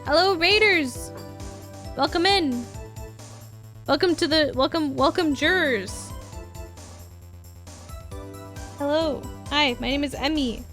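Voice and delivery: high, bright bubbly greeting; cute anime streamer. Real, not acted. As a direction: welcome the raiders sweetly.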